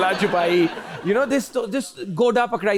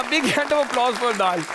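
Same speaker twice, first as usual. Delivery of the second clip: higher, hammed-up announcer cheer; mock applause call; 5 semitones higher than usual. Real, not acted. Host rallying applause for dal, playful announcer cheer.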